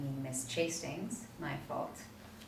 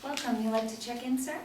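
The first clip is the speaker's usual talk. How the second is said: hotel clerk; 6 semitones higher, 4 dB louder than usual. Acted. Hotel clerk greeting guests.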